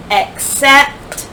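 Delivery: ordinary delivery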